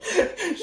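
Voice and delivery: a sudden high giggle; high burst of laughter. Real, not acted. Remembering his own slacking, a high giggle.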